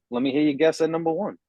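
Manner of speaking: ordinary talk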